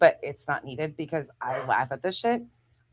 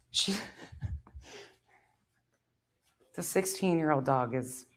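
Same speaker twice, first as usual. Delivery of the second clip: laughing whisper, much softer; 3 semitones lower, 10 dB quieter than usual. Real, not acted. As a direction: breathe it out with a giggle.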